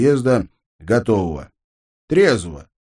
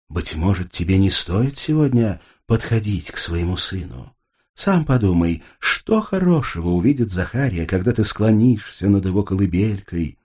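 lower calm angel voice; 5 semitones lower than usual. Acted. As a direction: speak low and gently like a stern angel. Angel character's voice.

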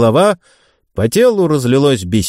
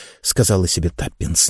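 usual speaking voice

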